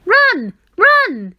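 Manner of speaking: high, loud urging call; urging shout. A narrator's reading. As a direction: press them to flee, loud and high. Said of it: "it urged once more".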